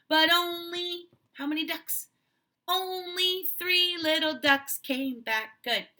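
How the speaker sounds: normal talking voice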